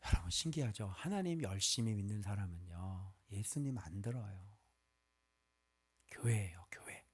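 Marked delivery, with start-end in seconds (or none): 6.1-7.0 whispered, like letting out a secret; hushed whisper. Acted. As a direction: drop to a low, voiceless hush. Preacher drops voice naming the church.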